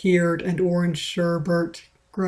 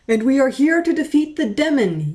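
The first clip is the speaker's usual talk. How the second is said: yelled; 7 semitones higher, 3 dB louder than usual. A narrator's reading. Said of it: "yelled Mew Pumpkin Pie".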